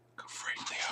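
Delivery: hushed soft aside; whispered aside. Real, not acted. Reader whispering to the child about the monsters. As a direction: murmur it to the listener.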